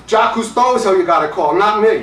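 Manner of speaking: loud, high comic exasperation; acted indignant Brooklyn outburst; exasperated refusal. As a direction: throw the absurd job back at him. Exasperated refusal in retold argument.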